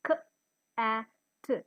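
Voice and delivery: c-a-t sounded out as separate short phonemes. Shown as a demonstration. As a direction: say k, a, t sound by sound with pauses.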